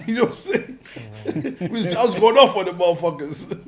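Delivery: high, loud laughing talk. Real, not acted. Laughing while telling.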